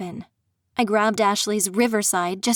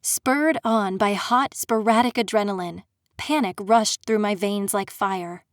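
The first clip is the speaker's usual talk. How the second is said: plain reading voice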